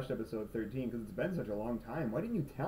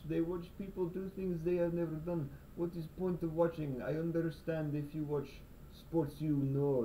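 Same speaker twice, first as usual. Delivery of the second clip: mock Russian accent, higher and lecturing; 4 semitones higher than usual. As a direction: rant like a puzzled Russian hairdresser. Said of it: "that's the best voice I can do".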